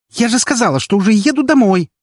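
sudden high, loud cry; sharp cry. A narrator's reading. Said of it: "Вскрик был таким резким".

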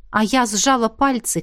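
usual speaking voice

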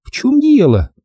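high-pitched bewildered woman; baffled lady's question; puzzled. Acted. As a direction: ask in high female puzzlement. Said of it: "спросила она недоумённо".

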